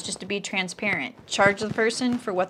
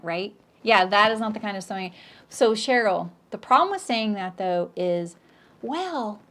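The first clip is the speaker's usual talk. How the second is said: normal talking voice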